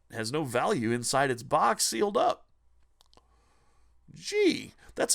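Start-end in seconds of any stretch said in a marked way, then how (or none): 4.1-4.7 squeaky high sarcastic gee; mock amazement. Sarcastic exclamation.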